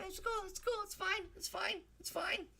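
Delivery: tiny high passive-aggressive voice; squeaky falsetto. Acted. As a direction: squeak it out, pretending it's fine. Brushing off viewers who won't subscribe.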